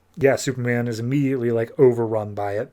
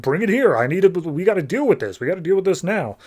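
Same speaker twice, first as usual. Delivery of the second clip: retold urgency, pitched up; urgent demand; 6 semitones higher than usual. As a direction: press him to act right away. Zod urgently demands the projector.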